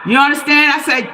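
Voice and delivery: usual speaking voice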